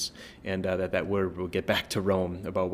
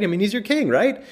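much higher, louder, incredulous; 12 semitones higher, 7 dB louder than usual. Acted. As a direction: throw it up high in disbelief. Mocking Pilate's question rhetorically.

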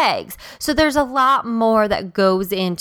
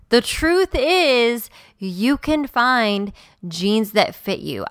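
ordinary talk

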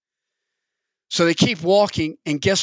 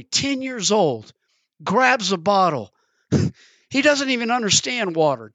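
usual speaking voice

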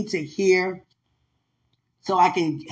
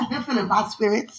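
playful churchy phrase; 3 dB quieter than usual. Pretend. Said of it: "I just like saying that".

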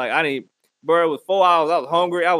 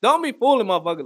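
ordinary talk, nothing marked

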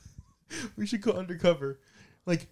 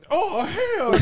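high, loud put-on yell; hammed-up outrage; 12 semitones higher, 4 dB louder than usual. Friend launches the same joke voice, loud high yell. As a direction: bark it up high like a caricature. Doing the voice again.

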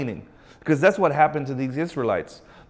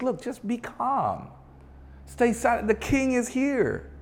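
higher, softer calming voice of the Lord; God speaking gently; 8 semitones higher, 4 dB quieter than usual. Acted. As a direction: reassure them as God would, softly. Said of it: "The Lord says".

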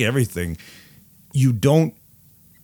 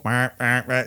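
short, higher bird squawks; squawking seabird imitation; 4 semitones higher than usual. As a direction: mimic a flock of cartoon seabirds. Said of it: "That's what we sound like".

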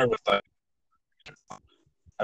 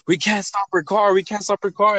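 higher-pitched frantic caller; mock-frantic plea for help; retold panic; 9 semitones higher than usual. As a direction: relay a panicked emergency call, voice pitched up. Retells panicked 911 callers.

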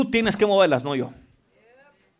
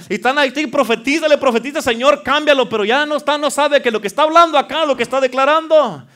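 raised, loud preaching voice; impassioned preaching; 7 semitones higher, 9 dB louder than usual. Real, not acted. Preacher rebuking those who speak negatively, impassioned. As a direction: build to a forceful command at the end.

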